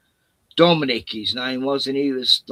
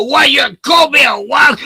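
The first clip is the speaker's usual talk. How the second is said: much higher, loud furious yell; outraged shout; 12 semitones higher, 8 dB louder than usual. Retold or imitated. Retelling mate confronting skinheads, shouting in outrage. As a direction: bellow the challenge at them. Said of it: "you go".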